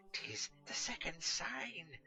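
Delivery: breathless whisper of revelation; awed whisper. Acted. Catweasel whispering to Cedric at the sign, awed. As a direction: whisper it like a secret omen.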